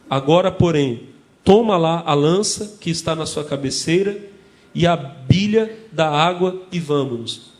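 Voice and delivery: slow, loud, weighty reading of the verses; solemn scripture reading. Acted. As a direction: proclaim the Bible verses slowly and loudly. Reading scripture aloud in sermon. (leitura solene e pausada das escrituras)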